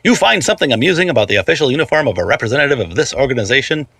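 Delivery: ordinary delivery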